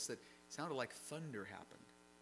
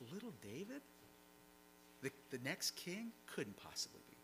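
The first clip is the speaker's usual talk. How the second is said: imagined disbelief; 2 semitones higher, 7 dB quieter than usual. Retold or imitated. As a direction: voice the brothers' private doubt, hushed. Voicing the brothers' disbelieving thoughts.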